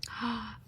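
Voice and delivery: a quick quiet intake of breath in surprise; soft gasp. Acted. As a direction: gasp softly as if shocked.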